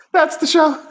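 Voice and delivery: high, silly cartoon voice; squeaky goofy character voice; Michael J Frog voice. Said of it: "what was that voice?".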